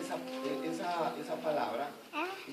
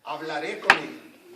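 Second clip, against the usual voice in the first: hablaré con él said slow and much louder; stress on hablaré; 10 dB louder, slower than usual. Shown as a demonstration. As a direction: punch out hablaré con él slowly. (hablaré con él, lento y muy fuerte)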